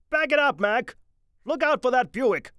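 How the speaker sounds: high, loud yell; attendant yelling. A narrator's reading. Parking attendant yelling at Mitty's car, loud. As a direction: yell at the driver across the lot.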